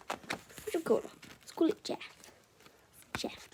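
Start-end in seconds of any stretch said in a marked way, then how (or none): none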